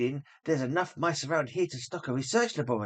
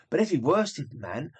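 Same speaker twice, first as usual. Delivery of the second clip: ordinary delivery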